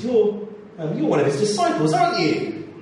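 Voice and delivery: a servant pressing Peter with a suspicion; accusing bystander. Acted.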